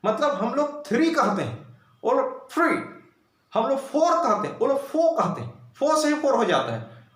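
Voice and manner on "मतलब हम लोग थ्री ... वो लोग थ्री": ordinary talk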